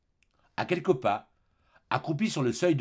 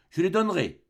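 mother agreeing; 7 semitones higher, 4 dB louder than usual. Acted. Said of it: "dit la mère".

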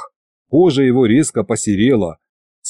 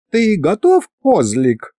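teasing goddess voice; 8 semitones higher, slower than usual. Acted. Goddess teases.

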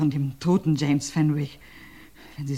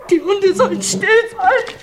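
calling out; 5 dB louder than usual. Acted. Jessie shouting to hush the barking dogs, calling out.

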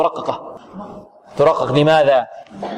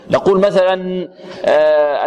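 usual speaking voice

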